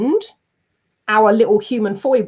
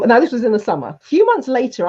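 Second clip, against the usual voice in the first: ordinary talk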